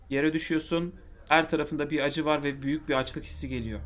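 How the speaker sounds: ordinary talk, nothing marked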